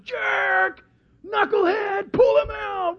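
high-pitched heckler's yells; acted high shouts of abuse; jeering crowd. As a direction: heckle like an angry fan. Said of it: "they're cursing this guy".

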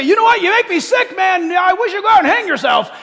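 high, loud mock tirade; acted angry outburst. Imagined speaker telling someone off, acted fury. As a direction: lash out loudly, pretending to be fed up. Example of hateful words to someone.

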